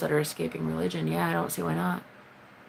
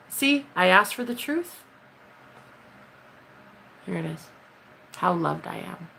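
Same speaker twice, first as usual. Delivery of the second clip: ordinary talk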